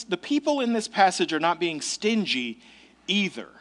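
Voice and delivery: ordinary talk, nothing marked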